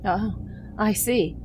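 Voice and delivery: ordinary talk, nothing marked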